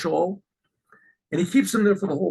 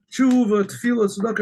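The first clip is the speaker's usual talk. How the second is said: mock congregational cry, higher pitched; imitated crying out; 5 semitones higher than usual. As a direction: call it out like praying worshippers. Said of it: "everyone's crying out".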